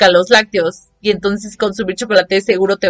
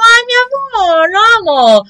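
adoring; 11 semitones higher than usual. Retold or imitated. Gazing at her boyfriend, high sweet cooing. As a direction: coo it like you adore him. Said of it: "lo volteaba a ver y es como".

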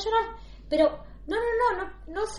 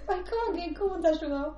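flustered, pitched up; 4 semitones higher, 3 dB quieter than usual. Real, not acted. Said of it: "Me pongo mal".

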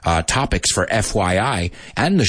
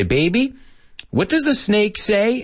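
put-on higher-pitched coaxing question to a little kid; parent to young child; 11 semitones higher than usual. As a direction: ask your small daughter, pitched up. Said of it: "I say".

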